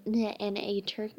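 plain reading voice

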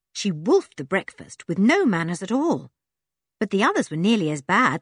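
usual speaking voice

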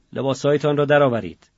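loud, curt command from a guard; gruff guard's loud command; barked order. Acted. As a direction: order them to strip, loudly. Guard orders prisoners to strip.

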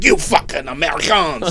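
mock Canadian French cop; exasperated Canadian. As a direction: scoff at the Americans. Canadian cop insults Americans.

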